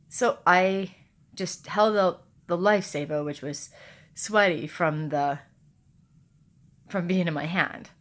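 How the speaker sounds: usual speaking voice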